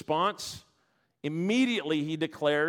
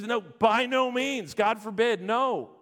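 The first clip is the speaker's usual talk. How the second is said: higher and slow, No, by no means; slow emphatic refusal; 3 semitones higher, slower than usual. Acted. Preacher voicing Paul's refusal to the objector, slow and emphatic. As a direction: drag out the no like a firm refusal.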